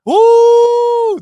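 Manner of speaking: loud, high whoop of delight; a host cheering his own rap, whooping; real excited cheer. Real, not acted. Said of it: "That was fire".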